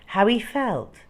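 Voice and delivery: plain reading voice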